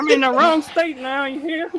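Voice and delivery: high, laughing Southern twang; Southern accent. Acted. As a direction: ham up a folksy Southern accent. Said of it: "with the Southern accent".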